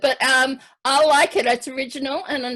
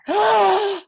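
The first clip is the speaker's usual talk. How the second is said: gasp; 8 semitones higher, 5 dB louder than usual. Real, not acted. Host reacts to glowing helmet.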